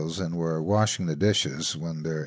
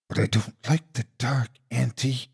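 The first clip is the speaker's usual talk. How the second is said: timid plea; 4 semitones higher than usual. A narrator's reading. Scared Shaky pleads.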